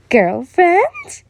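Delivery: loud, high rising question; mother teasing in disbelief; smirking surprise. A narrator's reading. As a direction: toss the word back, amused. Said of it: "she smirked".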